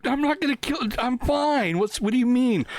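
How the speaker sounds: high, protesting mock self; squeaky imitation of himself protesting; defensive self. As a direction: play innocent and defensive. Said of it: "I'm like".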